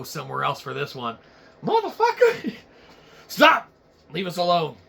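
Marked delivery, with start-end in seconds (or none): 1.6-3.7 high squeal. Acted.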